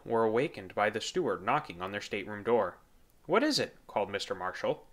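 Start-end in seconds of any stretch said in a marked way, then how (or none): 3.3-3.8 high, questioning call; a man calling out. A narrator's reading. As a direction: call out through the door. Marshall calls through the door.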